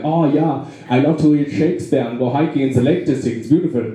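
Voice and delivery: mock German accent, full of approval; friendly German accent. Nice German praising England, mock German accent. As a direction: gush politely in a German accent. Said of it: "Germans are really nice about England".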